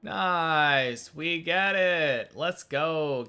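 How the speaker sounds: excited cheer. Real, not acted. Celebrating a win.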